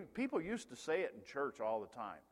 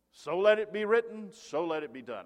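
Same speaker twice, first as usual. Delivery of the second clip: mock royal decree; 4 semitones higher, 9 dB louder than usual. Quoting film pharaoh's decree.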